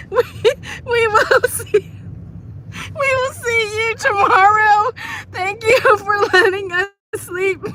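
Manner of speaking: high, laugh-strained goodbye; pushing words through giggles; real laughing while saying goodbye; still laughing. Real, not acted.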